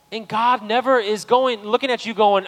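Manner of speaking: loud, high, fervent preaching to the church; full-force preaching. Real, not acted. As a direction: lift the room, preach it loud to the congregation.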